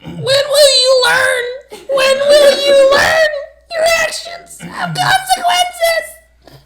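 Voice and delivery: very high, slow crying shout; dramatic wail. Acted. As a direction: wail it like a melodrama. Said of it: "decided to reenact this moment".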